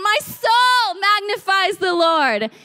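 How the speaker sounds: high, slow, exultant proclamation; loud praise. Acted. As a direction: belt it out as rising praise. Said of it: "you just start saying it loudly".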